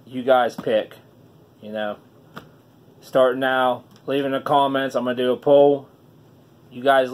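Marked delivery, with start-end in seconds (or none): none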